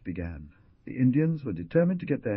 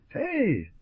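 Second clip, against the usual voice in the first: high-pitched call, little extra volume; high, restrained storybook shout; narrated shout; 11 semitones higher than usual. A narrator's reading. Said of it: "decided to be the first to speak and shouted".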